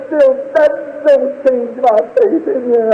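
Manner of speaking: high, slow, trembling-hearted avowal; grieving faith. Retold or imitated. Bereaved father to God, grieving but faithful. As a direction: profess faith through heartbreak.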